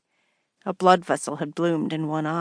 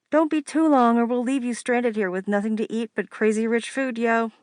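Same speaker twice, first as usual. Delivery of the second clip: higher teasing sister voice; 6 semitones higher than usual. Acted. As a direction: needle her like a bossy sister.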